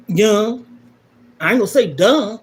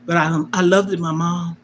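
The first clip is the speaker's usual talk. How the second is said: ordinary talk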